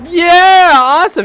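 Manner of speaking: loud, high yay; excited cheer. Real, not acted. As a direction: burst out cheering. Can slide, delight.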